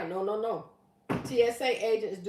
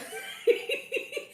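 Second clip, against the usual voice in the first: laughing. Real, not acted. Laughing at comment.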